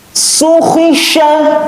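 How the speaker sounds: high, crisp Quran letters; fast, firm recitation. Shown as a demonstration. Teacher shows pupils fast, firm letter reading, high and crisp. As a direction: snap out each syllable firmly. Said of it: "Tekan gitu ya".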